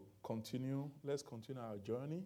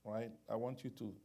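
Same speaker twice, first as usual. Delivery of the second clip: ordinary talk, nothing marked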